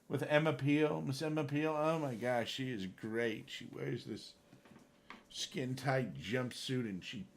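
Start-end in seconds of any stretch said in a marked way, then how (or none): none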